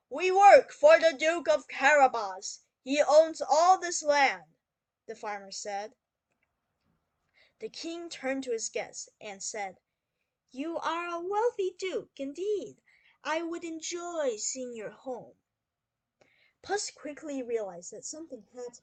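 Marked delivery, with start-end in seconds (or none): 0.1-4.4 loud, higher-pitched farm worker's answer; farmhand calling out. Acted. Farmhand answering the king in his carriage, loud dutiful declaration. 10.5-15.4 lightly voiced, higher regal voice; a hint of a courteous monarch; impressed king. King complimenting his wealthy guest, lightly played regal admiration.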